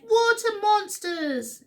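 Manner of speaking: high, loud, drawn-out cry of alarm. A narrator's reading. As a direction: wail it slowly in storybook panic. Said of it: "and they cried".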